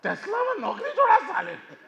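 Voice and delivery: high, loud, fast outburst; furious rant. Acted. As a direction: explode at the son's reckless choice. Furious father about son.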